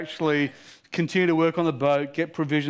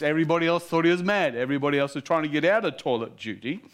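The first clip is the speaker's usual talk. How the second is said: ordinary talk, nothing marked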